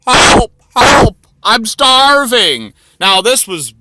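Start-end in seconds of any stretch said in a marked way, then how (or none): 0.1-2.7 desperate loud cry for help; frantic starving yell; shouting for help. Acted. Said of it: "And the guy says".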